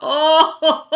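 loud, high belly laughter; forced laughter. Shown as a demonstration. Laughter yoga teacher leading belly laughs, loud high laughing. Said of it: "Okay, so here we go".

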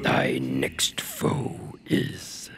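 deep, slow, breathy god whisper; Dormin's voice. Acted. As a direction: intone like an ancient disembodied god. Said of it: "who's doing, uh, Dormin's voice".

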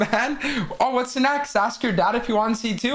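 normal talking voice

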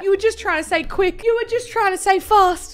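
high-pitched, protesting voice; mock indignant defence. Defending the writer against the judging friend, mock indignant. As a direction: plead their case way up high.

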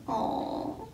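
usual speaking voice

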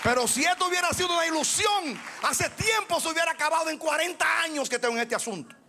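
a fired-up preacher pleading with the church; fiery preaching. Real, not acted. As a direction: raise the voice and drive each question home. Preaching at full force.

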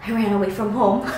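small, soft retold admission; quiet confession. Retelling runaway's confession to boyfriend, quietly sheepish. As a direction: softly admit it, imitating a sheepish child.

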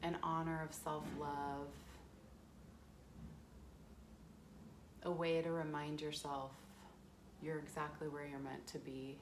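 calm, unhurried guiding into the breath; guided meditation. Acted.

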